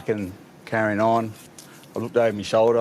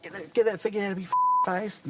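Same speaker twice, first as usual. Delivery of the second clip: annoyed bloke; 10 semitones higher than usual. Retold or imitated. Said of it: "he's like".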